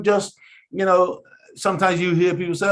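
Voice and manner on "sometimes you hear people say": ordinary delivery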